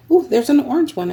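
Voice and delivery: light real surprise at a find; delighted surprise. Real, not acted. Finding an orange panel in the lot, pleasantly surprised.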